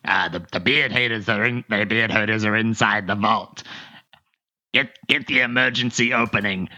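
a hint of a frantic raiding party; beard haters. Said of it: "and you hear".

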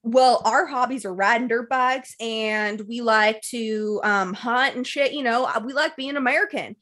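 slow, drawn-out mock Southern accent. Brother explaining the family's hobbies, mock Southern accent. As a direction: imitate a Southern good old boy's accent. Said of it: "a tiny bit of a Southern accent".

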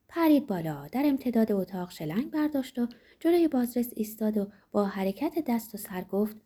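usual speaking voice

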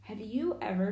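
ordinary delivery, nothing marked

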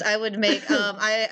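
ordinary talk

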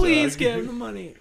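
high, laughing fake plea; playfully panicked hostage; mock pleading hostage. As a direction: beg in a hammed-up high voice.

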